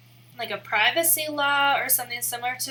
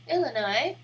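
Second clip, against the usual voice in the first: ordinary talk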